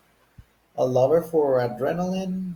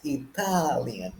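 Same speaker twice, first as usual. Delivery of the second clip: Italian said slowly, stressed on TAL; slow i-TAAL-ian, falling at the end; stress on second syllable; 3 dB quieter, slower than usual. Shown as a demonstration. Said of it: "Check how the stress changes".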